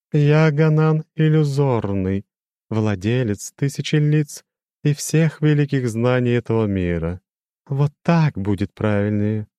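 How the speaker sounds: high, slow, ringing demon voice; eerie chiming demon, slow and lofty; crystal ringing voice. A narrator's reading. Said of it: "прозвенел демон своим хрустальным голосом". (высокий звенящий хрустальный голос демона)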